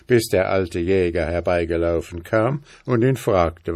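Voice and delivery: ordinary delivery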